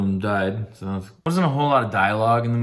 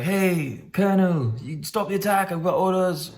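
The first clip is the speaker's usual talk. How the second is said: higher, urgent mock soldier voice; 8 semitones higher than usual. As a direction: burst in with orders, played up. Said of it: "he's like".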